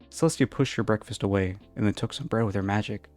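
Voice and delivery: usual speaking voice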